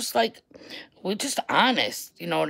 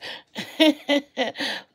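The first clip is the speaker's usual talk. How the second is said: mock fake laugh; 8 semitones higher than usual. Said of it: "it sounded fake".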